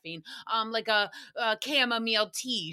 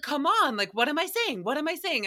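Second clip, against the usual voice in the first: higher-pitched, insistent mock pestering; insistent questioning; 6 semitones higher than usual. Crush presses her to guess the words, mock insistent. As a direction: imitate a kid pushing for an answer.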